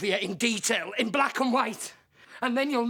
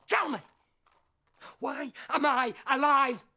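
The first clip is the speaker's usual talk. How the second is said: ordinary delivery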